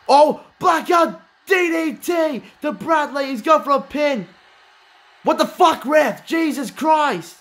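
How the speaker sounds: high, drawn-out shouting; excited yell. Real, not acted. Commentator yelling at the ref over a pin, excited. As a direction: scream it like the finish is here. Commentator yells at DDT finish.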